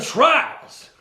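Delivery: high, loud announcer yell; hype shout. Acted. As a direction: belt out the show title. Hyped show intro shout.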